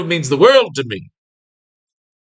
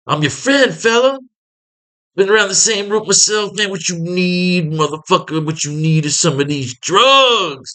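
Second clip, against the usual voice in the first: sleazy pusher voice; 4 semitones higher than usual. Acted. Drug pusher tempting Harry.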